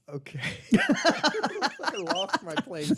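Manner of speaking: laughing through the words; giggling helplessly while talking. Real, not acted. Laughing fit.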